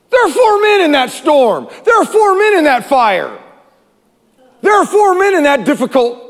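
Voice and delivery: high, impassioned preacher's cry; astonished proclamation. Acted. Preacher repeats the king's discovery to congregation, high cry. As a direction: cry each sentence out, falling at the end.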